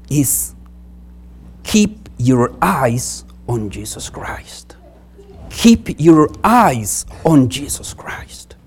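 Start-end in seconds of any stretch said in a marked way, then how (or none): none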